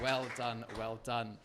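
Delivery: ordinary talk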